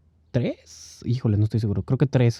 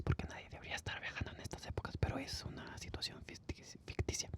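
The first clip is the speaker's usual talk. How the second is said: whispered aside; 27 dB quieter, faster than usual. Real, not acted. Aside about not travelling now.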